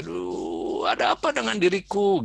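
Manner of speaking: mock-anxious teenager asking what's wrong with me; fretting teen. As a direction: panic over one pimple like a teenager. Teen fretting over a pimple.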